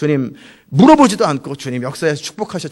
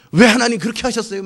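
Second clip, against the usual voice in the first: higher, louder mock protest; confronting God; 6 semitones higher, 5 dB louder than usual. Believer challenging God right after a devout prayer, protesting. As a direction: demand an explanation, voice lifted. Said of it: "막 따지다".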